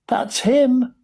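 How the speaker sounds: very high excited crowd cry; shouting. A narrator's reading. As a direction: call out high, thrilled to recognise him. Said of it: "somebody shouted".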